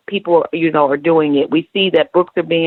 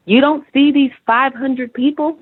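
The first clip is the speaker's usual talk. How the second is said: incredulous; 7 semitones higher than usual. Retold or imitated. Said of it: "I looked at him one day and I said".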